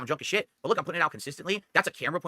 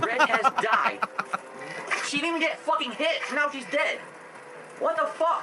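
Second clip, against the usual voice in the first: shrill angry yelling at the game; 11 semitones higher, slower than usual. Real, not acted. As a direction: rage at the dead companion. Drunk gameplay clip, raging.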